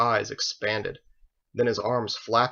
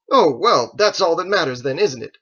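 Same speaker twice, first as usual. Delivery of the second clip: sarcastic retort; 7 semitones higher, 10 dB louder than usual. Acted. Joseph sarcastic after rebuff.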